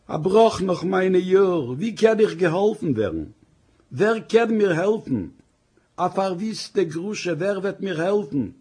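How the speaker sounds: keen over her lost years; lament. Acted. Wife laments being cast off after ten years, mournful wailing.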